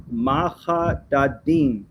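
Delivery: "Mahadadim" sounded out slowly, syllable by syllable, with throaty h; mispronounced Hebrew word. Real, not acted. Man reading an AI answer aloud, "Mahadadim" slowly sounded out. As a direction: stretch "Mahadadim" into ma-kha-da-deem. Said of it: "you're pronouncing it wrong".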